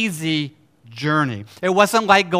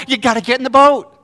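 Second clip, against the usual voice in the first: loud, raised, quick shout; urgent command; 6 semitones higher, 8 dB louder, faster than usual. Real, not acted. Preacher urging them to get in the boat, urgent command. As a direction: fire the order out fast and loud. Repeats the imperative at force.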